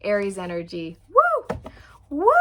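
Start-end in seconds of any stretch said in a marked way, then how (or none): 1.1-2.3 high, loud whoop of joy; excited cheer; cheering woo. Real, not acted. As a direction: whoop like at a concert. Cheering at Aries energy.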